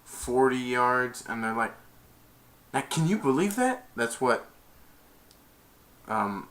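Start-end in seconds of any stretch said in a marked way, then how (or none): 2.7-3.9 high, rising amazed question; imitated disbelief. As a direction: act out an incredulous onlooker. Retold amazed onlookers.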